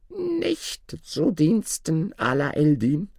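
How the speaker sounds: high, drawn-out dwarf refusal. Acted. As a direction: stretch it slowly in a high dwarf voice. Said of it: "Da erschien der Zwerg". (hohe, gedehnte Zwergenstimme)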